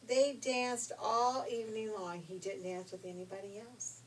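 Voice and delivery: usual speaking voice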